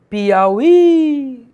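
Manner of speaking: Piauí with the final í held long, high and loud; stress on final I. Shown as a demonstration. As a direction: punch and stretch the last í in Piauí. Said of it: "a sílaba tônica é esse I aqui".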